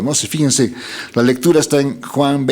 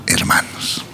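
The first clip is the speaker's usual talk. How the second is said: dramatic whisper; 4 dB quieter than usual. Acted. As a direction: whisper the last word. (susurro dramático)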